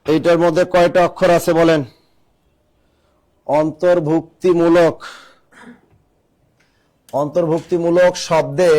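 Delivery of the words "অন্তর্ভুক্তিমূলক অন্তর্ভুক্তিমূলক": slow, word drawn out part by part; slow dictation. Real, not acted. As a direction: dictate it slowly for students writing it down. Teacher dictates word for writing. (ধীরে ধীরে শ্রুতিলিখন)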